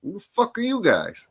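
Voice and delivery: high mock gruff challenge; Fairway confronting the Helium guys, high mocking voice; quoting Fairway.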